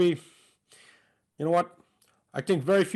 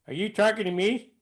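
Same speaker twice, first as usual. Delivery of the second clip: higher, misfired De Niro voice; botched impression, pitched up in the wrong character; De Niro impression gone wrong; 5 semitones higher than usual. Shown as a demonstration. Impressionist stuck in the previous character, De Niro line in wrong voice. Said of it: "I got the wrong face on".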